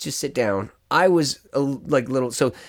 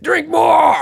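loud, high mock shout; shouted demand; 7 dB louder than usual. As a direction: bellow it like a wild drinking buddy. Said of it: "he's like".

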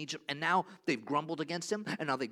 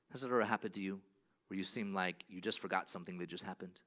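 ordinary talk

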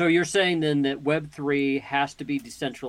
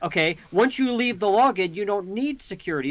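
usual speaking voice

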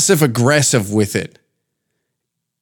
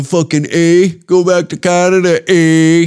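higher-pitched taunting imitation; mocking heckler; 4 semitones higher, 3 dB louder than usual. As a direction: jeer like someone teasing a Canadian. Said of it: "they still hit you with that".

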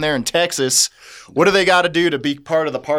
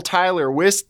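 ordinary delivery

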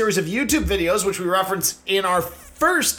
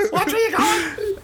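high, laughing mock yell at a cyclist; mock road-rage yell; 11 semitones higher than usual. Mocking a reckless cyclist, laughing high yell. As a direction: yell at the bike rider while cracking up.

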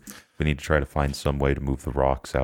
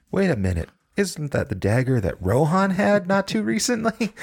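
mock innocent; 3 dB louder than usual. Mock-innocent accusation in character.

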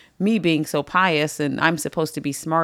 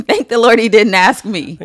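louder, higher, laughing "Thank the Lord"; laughing exclamation; 5 semitones higher, 11 dB louder than usual. Real, not acted. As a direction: burst out with a laugh, much louder. Repeated punchline, plain then exclaimed.

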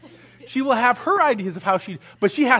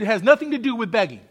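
usual speaking voice

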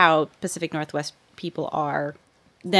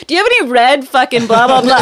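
much louder and higher, laughing; rude laughing demand; 7 semitones higher, 11 dB louder, faster than usual. Retold or imitated. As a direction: bark the blunt question while cracking up. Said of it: "you'll be like".

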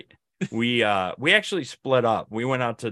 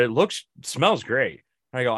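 normal talking voice

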